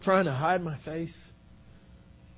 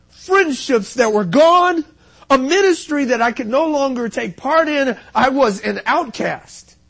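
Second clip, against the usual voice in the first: raised, forceful confession; impassioned preaching; 8 semitones higher, 9 dB louder than usual. Real, not acted. Preacher confesses ruined marriage and lost ministry, impassioned preaching. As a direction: pour out the losses at full preaching pitch.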